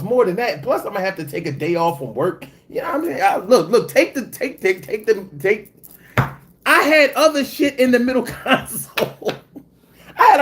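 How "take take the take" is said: ordinary talk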